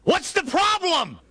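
slow, high-pitched holler; yelling back. Acted. Jesus to panicked apostles in the boat, high drawn-out yell. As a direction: shout it over howling wind. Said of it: "he has to yell back".

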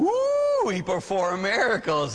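high swooping ooh, then mock awe; sarcastically awed echo of a kid's answer; mock amazement. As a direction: pretend to be hugely impressed.

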